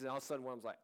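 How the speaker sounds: ordinary delivery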